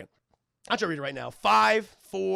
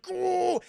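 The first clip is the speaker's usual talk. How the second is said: high, drawn-out straining ooh; mock strain; 12 semitones higher than usual. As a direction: moan high as if lifting hard. Imitating Yoda straining.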